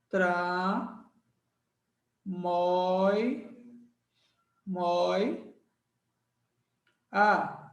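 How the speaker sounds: "Tra-moi-a" in isolated syllables with long gaps; separando sílabas. Shown as a demonstration. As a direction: spell out "Tra-moi-a" piece by piece, unhurried. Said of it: "vamos separar". ("Tra-moi-a" sílaba por sílaba, com pausas)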